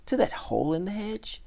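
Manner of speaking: high, rising disbelief; scornful, high-pitched query; incredulous question. A narrator's reading. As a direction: ask it in disbelief, swooping upward. Town mouse incredulous at friend leaving.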